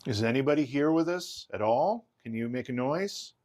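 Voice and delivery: loud, high-pitched call out. Real, not acted. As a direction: coax a noise out of the dark. Calling out to spirits.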